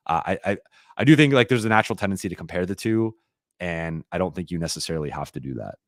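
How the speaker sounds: usual speaking voice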